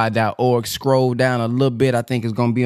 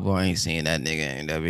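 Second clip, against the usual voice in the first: mock aside; 8 semitones lower, 5 dB quieter than usual. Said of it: "Y'all be".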